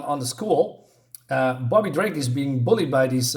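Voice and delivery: usual speaking voice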